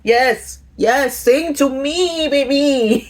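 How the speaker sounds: high, slow, swooning cheer; high drawn-out tipsy cheering. Retold or imitated. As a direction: egg on the band like a tipsy fan. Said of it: "And I'm like".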